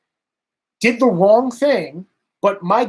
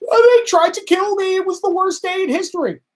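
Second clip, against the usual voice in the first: much higher, whiny mock complaint; whiny mock AOC; 11 semitones higher than usual. Mocking a politician's overblown complaint, high and whiny. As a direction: squeal it like an overdramatic victim. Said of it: "the second she gets a little uncomfortable".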